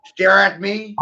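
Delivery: usual speaking voice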